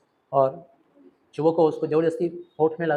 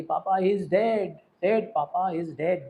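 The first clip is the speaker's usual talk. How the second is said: usual speaking voice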